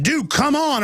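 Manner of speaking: calling out. Retold or imitated. Calling out to cameraman.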